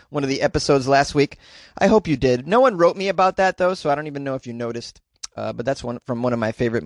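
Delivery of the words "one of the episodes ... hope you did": usual speaking voice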